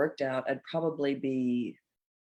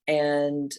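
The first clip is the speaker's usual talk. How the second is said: ordinary delivery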